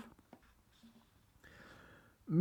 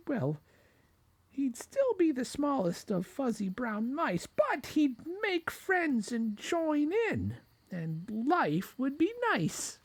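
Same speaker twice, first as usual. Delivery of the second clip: high, dreamy little mouse; squeaky mouse; 14 semitones higher than usual. A narrator's reading. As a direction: muse aloud as a hopeful mouse. Mouse daydreams aloud.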